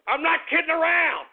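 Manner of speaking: high, emphatic protest; exasperated insistence. Real, not acted. Insisting he is serious.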